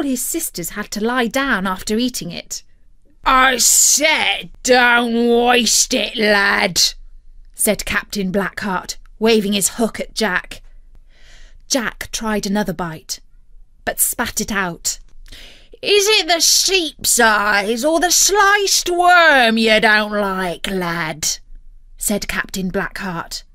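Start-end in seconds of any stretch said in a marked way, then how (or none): 3.2-6.9 loud, drawn-out pirate order; big slow pirate voice; pirate captain, insistent. A narrator's reading. 15.8-21.4 big drawn-out pirate question; pirate captain, menacing. A narrator's reading.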